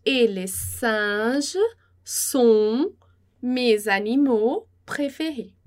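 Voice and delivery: slow, carefully spaced French; slow model reading. Shown as a demonstration. Teacher reading slowly to class, slow and clear. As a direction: read it out slowly for students. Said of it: "Então, mais uma vez".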